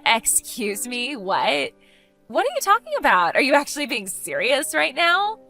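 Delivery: scandalised astonishment; stunned disbelief. Acted. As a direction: question it as if it cannot be true. Stunned by rival's engagement news.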